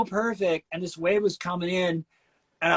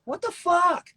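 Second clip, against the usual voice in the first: indignant rival; 13 semitones higher, 3 dB louder than usual. Retold or imitated. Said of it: "looking at me like".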